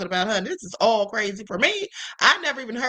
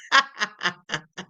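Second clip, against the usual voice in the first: short staccato laugh; real amused cackle at a caption; 2 semitones lower than usual. Real, not acted. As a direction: laugh in quick separate bursts. Amused by reposted crash-out caption.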